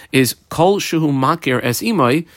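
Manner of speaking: usual speaking voice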